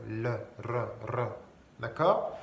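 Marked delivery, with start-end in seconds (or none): 0.3-1.3 repeated trilled "r"; tongue-rolled R. Shown as a demonstration. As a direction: roll each "r" with the tongue. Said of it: "comme ça qu'on y arrive". (« r » roulé avec la langue)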